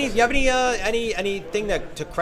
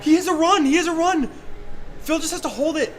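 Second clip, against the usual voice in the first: high-pitched excited race call; excited commentary; 10 semitones higher than usual. Real, not acted. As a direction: call the finish, keyed up. Tense race finish commentary.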